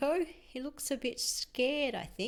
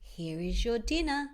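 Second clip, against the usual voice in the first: plain reading voice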